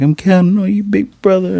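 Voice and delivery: high-pitched imitation of little sister; put-on high little-sister voice; little sister's voice. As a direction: pitch it way up, trusting kid sister to big brother. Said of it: "she hugs him like".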